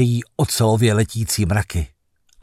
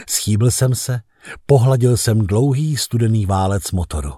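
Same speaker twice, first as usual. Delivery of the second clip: ordinary delivery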